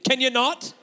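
mock-exasperated complaint from bed; annoyed wife. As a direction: scold him like a woken light sleeper. Wife annoyed at his breathing.

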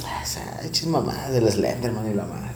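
low dismissive mutter under breath; muttered scoff. Retold or imitated. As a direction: scoff it away quietly, half-voiced. Retelling his scoffing reply.

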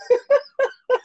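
squeaky high laugh; high burst of laughter. Real, not acted. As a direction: cracks up in a shriek. Partner's phone just died.

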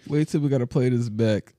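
normal talking voice

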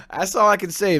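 loud, high laughter bursting through the words; big laugh. Real, not acted. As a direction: crack up laughing at it.

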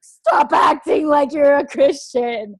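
high, slow, shouted rebuke; booming slow divine scolding; stern God. Retold or imitated. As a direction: scold her like an angry father from above. God scolding her in the cathedral.

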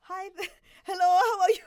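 high, loud, badly faked Indian accent; hammed-up Indian accent cracking into laughter. Waitress putting on an Indian accent for a phone customer, badly faked. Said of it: "I can't even do an Indian accent".

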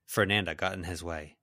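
ordinary delivery, nothing marked

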